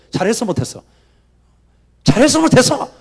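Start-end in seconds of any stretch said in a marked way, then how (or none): 2.0-2.9 높고 크게 외치는 군중 흉내; 성난 군중처럼 소리쳐 따지듯; 소리 지르기. Acted. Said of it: "그럼 막 소리 지르고". (따져 묻는 군중의 고함)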